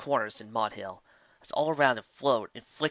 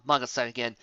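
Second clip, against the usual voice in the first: ordinary delivery, nothing marked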